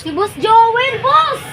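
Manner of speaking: high, loud cheer for the boss; greeting the boss with loud cheers; excited greeting. Acted. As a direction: hail him like a celebrity. Cheering the boss's arrival.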